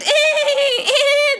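high loud whinny; horse neigh. Retold or imitated. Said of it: "The horses went".

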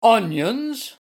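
high, loud, incredulous outburst; thundering. A narrator's reading. Gardener hearing his bulbs called onions, thundering. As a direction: thunder the word back in disbelief. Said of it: "thundered Mister Carr".

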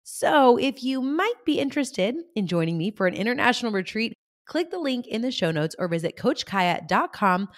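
upbeat promo read with punched key words; lively, emphatic sales delivery. Acted. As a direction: pitch it like a podcast advert. Recorded retreat promo.

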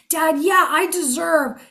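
a hint of a small son; little boy. Boy to dad: lightly voiced childlike voice. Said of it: "The little boy isn't going".

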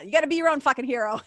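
ordinary talk, nothing marked

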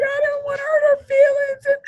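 very high, loud imitation sobbing wail; imitating yourself in tearful squeal; mock crying. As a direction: wail it like you're bawling, hammed up. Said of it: "crying or whatever".

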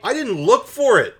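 high, loud, frantic squeal; panicked shriek. Retold or imitated. Bess shrieking to Nancy about the upset owners.

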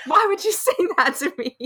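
high, loud mock-horrified outburst through laughter; laughing protest. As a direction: squeal the protest, cracking up. Retold horrified reply, breaking into laughter.

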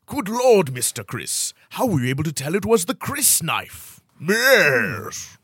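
hammed-up British detective, swooping in pitch; British accent. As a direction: quiz him in theatrical British. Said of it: "Most Britishy answer wins".

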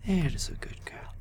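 high whispered praise; tender praise. Acted. Paladin praising the girl as she eats, whispered.

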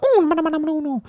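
very high, silly cartoon squeal; high squeaky Mario gibberish. Mocking the expected Mario voice, high squeaky nonsense. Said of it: "expecting Mario to be getting around like".

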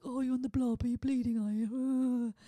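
put-on jeering voice, drawn out at the end; mocking retort. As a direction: mimic a dismissive bloke's taunt. Mocking a boyfriend's dismissive retort.

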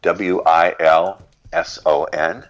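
letter by letter, slowly and clearly; spelled out slowly. Shown as a demonstration. Spelling the name slowly for the host, letter by letter. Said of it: "What's the author's name?".

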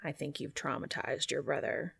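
low, hushed deadpan; lightly voiced Cass. A narrator's reading. Cass tells Dean about his brother, low quiet remark.

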